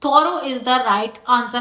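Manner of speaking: slow, clear answer read-out; deliberate classroom reading. Shown as a demonstration. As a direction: announce the answer slowly. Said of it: "इसका option होगा thorough".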